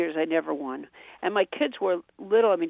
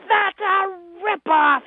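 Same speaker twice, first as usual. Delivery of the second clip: put-on much higher, louder, slow outraged child voice; indignant kid; 12 semitones higher, 6 dB louder, much slower than usual. Little son objecting to his mom, indignant child voice. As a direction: play a small boy scandalized by no prize. Son told no prize given.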